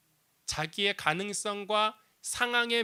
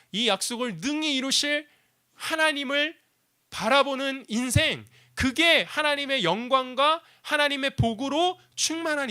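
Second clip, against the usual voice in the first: high, loud, driving sermon voice; fervent preaching; 9 semitones higher, 6 dB louder than usual. Acted. Pastor preaching to the congregation, at full force. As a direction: preach it out to the whole room.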